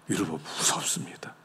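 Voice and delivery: whispered, low warning; hushed warning. Real, not acted. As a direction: drop to a whisper to warn them. Awed warning about the law. (낮게 속삭이며 경고하듯)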